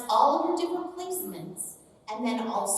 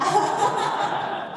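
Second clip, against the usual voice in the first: high laugh; 11 semitones higher, 6 dB louder than usual. Real, not acted. Laughing at her own voice.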